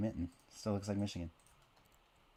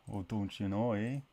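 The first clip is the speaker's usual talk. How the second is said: exaggerated Canadian accent; 2 semitones higher than usual. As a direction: lay the Canadian accent on thick. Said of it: "exaggerate the accent".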